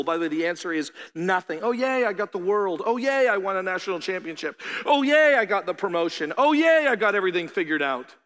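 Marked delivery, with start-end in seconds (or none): 1.6-8.2 sarcastic little cheers, pitch jumping up on each yay; mock cheering. Preacher mocks people celebrating worldly gains with sarcastic cheers. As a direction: lampoon someone gloating over trophies.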